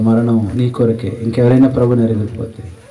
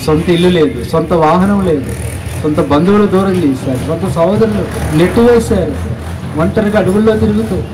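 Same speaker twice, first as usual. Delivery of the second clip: impassioned preaching; 8 semitones higher than usual. Real, not acted. Preacher recounting Christ's lonely hardships to the congregation, building in fervour. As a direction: pitch climbing through a sermon list.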